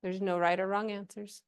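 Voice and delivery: usual speaking voice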